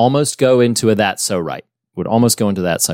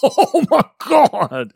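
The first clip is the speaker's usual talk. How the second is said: drawn-out, much higher, slow disbelief; stunned oh my God; much slower than usual. Retold or imitated. Host recalling the girl's chilling line, stunned and slow. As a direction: stretch it out in creeped-out awe. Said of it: "I'm like".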